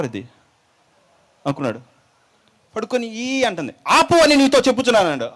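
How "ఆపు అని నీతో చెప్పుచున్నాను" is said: loud, high rebuke; commanding shout. Acted. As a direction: roar the command to stop. Disciple commands demon to stop.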